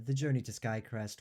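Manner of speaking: ordinary talk, nothing marked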